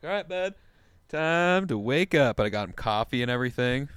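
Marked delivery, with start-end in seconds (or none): 0.0-2.4 mock cheery wake-up call. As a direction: coax a sleeping buddy awake. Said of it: "I'm like".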